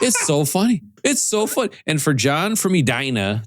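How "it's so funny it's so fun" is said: higher, gushing fan voice; gushing imitation. Quoting John's praise of the podcast in a higher, gushing imitation. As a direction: gush like a giddy fan.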